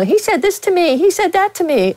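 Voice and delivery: high, mock complaining voice like running to the boss; whiny tattletale. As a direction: whine it like a tattletale telling on someone. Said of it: "and say".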